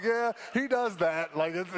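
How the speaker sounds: retold reply, laughing through the words; laughing shrug. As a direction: chuckle it out fondly. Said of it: "And I'm like".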